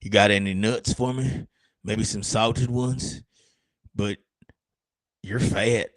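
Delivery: a lightly voiced mocking squirrel; cheeky squirrel voice. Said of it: "And they're like".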